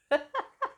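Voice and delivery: short high giggle; high embarrassed giggle. Real, not acted. Vlogger laughing at herself after yawning on camera. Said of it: "I'm so sorry".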